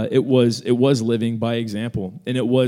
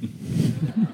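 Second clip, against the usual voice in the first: normal talking voice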